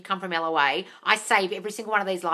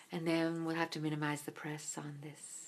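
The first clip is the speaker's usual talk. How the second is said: very soft, low icy quote; a hint of a cold editor; movie quote; 5 semitones lower, 15 dB quieter than usual. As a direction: say it coolly like a boss. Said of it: "There's a quote from it".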